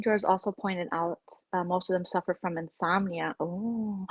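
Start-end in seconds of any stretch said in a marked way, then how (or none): none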